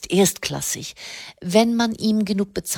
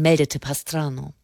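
plain reading voice

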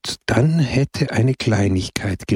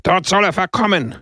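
roaring; 9 semitones higher than usual. A narrator's reading. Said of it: "brüllte die Versammlung".

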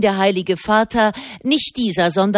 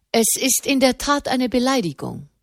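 a man's voice pitched up high; lightly voiced high-pitched statesman; oddly high voice; 7 semitones higher than usual. A narrator's reading. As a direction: speak oddly high for a man. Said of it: "Seine Stimme klang merkwürdig hoch für einen Mann".

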